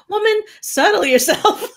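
mock telling his wife off; stern sheriff. Sheriff scolding his flirty wife, lightly mocked sternness. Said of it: "He's like".

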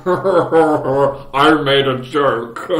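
slow, drawn-out joke voice; a lightly voiced silly character; silly put-on voice. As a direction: stretch it out in a goofy voice. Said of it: "what was that voice?".

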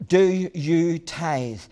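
very slow, each word weighed; missionary grilling his helper, slow and stern; slow stern question. Retold or imitated. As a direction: press him with a drawn-out demand. Missionary asks final time.

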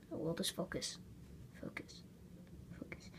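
breathy whisper, almost silent. Real, not acted. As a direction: coax it under your breath. Whispering to the camera to focus.

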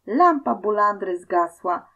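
ordinary delivery, nothing marked

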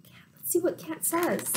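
soft, quick whisper; hushed, hurried storytime aside. Real, not acted. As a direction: whisper it to the little listeners. Hushed aside to young listeners.

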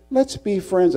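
high put-on friendly plea; mock a mild schoolboy offering friendship; high-pitched imitation of a peacemaker; meek classmate. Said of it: "He says".

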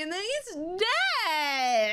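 slow, choked-up words, voice catching; tearful. Real, not acted. Viewer stunned that the character died, voice choking up. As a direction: let the grief slow you down as it lands. Anime father's death hits her.

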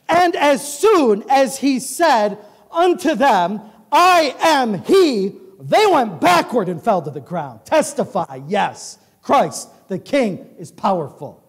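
loud, slow pulpit proclamation; preaching at full force. Acted. Preaching the arrest scene.

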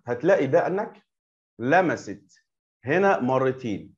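ordinary delivery

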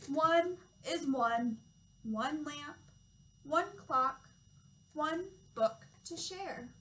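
slow, lilting read-aloud with each number drawn out; reading to children. Acted. Toddler teacher reading a counting book to children, slow storytime voice. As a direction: read it slowly and clearly for little ones.

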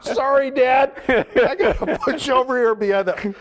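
high, loud joking through laughter; laughing mock apology. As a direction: crack up while pretending to apologize to Dad. Jokes about dumping ashes while laughing.

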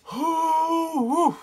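high, drawn-out woo; high hooting exhale of shock; shaken whoo. Real, not acted. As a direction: let out a long high whoo. Shaken reaction to horrific page.

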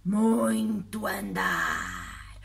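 deep, very slow monster drawl; friendly monster. Acted. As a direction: drag it out like a tired giant. Monster answers slowly.

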